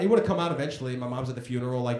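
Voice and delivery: ordinary talk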